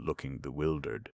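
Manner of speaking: plain reading voice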